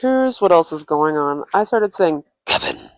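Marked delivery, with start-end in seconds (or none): 2.5-2.9 very low, growling demon Kevin. Retold or imitated. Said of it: "in demon voice".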